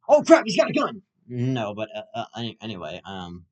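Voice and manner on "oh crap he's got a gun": high loud mock alarm; mock panic. Podcaster panics at armed driver, mock fear. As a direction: blurt out in fake panic.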